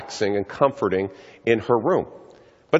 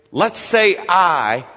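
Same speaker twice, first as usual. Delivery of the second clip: slow coaxing mom voice, drawn out; mother coaxing her daughter to speak, slowly; 4 semitones higher, 4 dB louder, much slower than usual. Retold or imitated. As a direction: coax a sick daughter gently, slow. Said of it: "She said".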